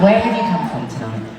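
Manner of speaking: ordinary talk, nothing marked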